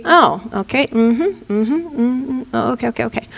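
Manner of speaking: quiet, unbothered soldier murmuring acknowledgments; a hint of a seasoned soldier taking chaos in stride; calm warrior. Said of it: "His reaction would be".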